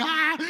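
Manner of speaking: high, loud excited "ah"; stoked yell. Retold or imitated. Storyteller acts out his shock at her reaction, stoked yell. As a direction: burst out with a thrilled cry. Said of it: "I was stoked".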